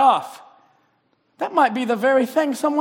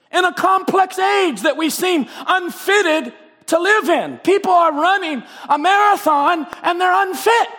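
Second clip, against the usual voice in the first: rising, shouted preacher's cry; fervent preaching; 3 semitones higher than usual. Acted. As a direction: preach it hard to the congregation. Preaching at full force.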